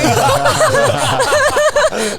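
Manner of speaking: loud laugh rolling on; long high laugh. Real, not acted. Guest laughs loud at her own lost English card.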